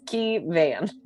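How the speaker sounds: "key van" as two plain English words; literal pronunciation. Shown as a demonstration. Player explains how she would misread the name, literal pronunciation. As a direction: say "key van" exactly as spelt, KEY then VAN. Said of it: "take it literally as".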